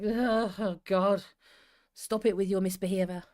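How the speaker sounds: drawn-out exasperated sigh into a complaint; exasperated groan. Acted. Gen Xer fed up with intolerance, exasperated groan.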